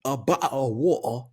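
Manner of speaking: put-on playful British accent with dropped T's; English accent. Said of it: "my English accent is".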